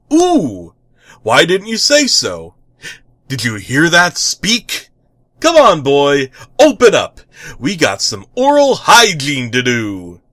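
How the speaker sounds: loud, cheerful superhero voice; eager hero. Acted. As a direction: coax the pet with gusto. Hero coaxing pet in bath.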